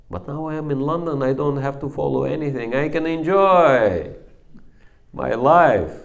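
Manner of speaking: quick, high, rising to loud on enjoy; mock the young man's glee at his freedom; a hammed-up, carefree young man; carefree boast. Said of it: "He said".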